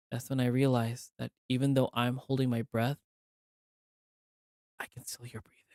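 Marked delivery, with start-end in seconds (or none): 4.8-5.6 hushed, breathy whisper; eerie whisper. A narrator's reading. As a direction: breathe it out in dread. Creepy figure in the trees.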